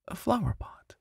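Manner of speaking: hushed suspenseful whisper. A narrator's reading. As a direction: breathe it like a secret. Suspense as McGregor searches pots.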